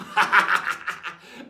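loud rattling guffaw; pirate laugh. Acted. Pirate jokes about the paint, loud guffaw. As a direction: burst into a staccato pirate laugh.

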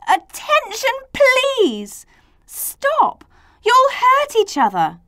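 high, loud anxious plea; stammered. A narrator's reading. A trying to stop the squabbling letters, high flustered plea. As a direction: plead urgently, pitched right up. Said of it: "A stammered".

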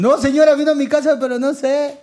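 playing the flustered guy explaining himself; mock talking to her mom. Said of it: "¿Cómo le digo?". (excusa nerviosa fingida a la señora, más aguda)

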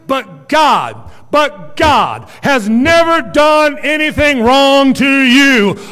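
loud, slow preaching climax; full-force preaching. Acted. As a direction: drive it home to the congregation.

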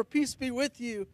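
usual speaking voice

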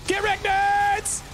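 high, slow drawn-out taunt. Pretend. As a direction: gloat at the enemies. Taunting on the bike.

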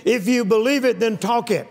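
usual speaking voice

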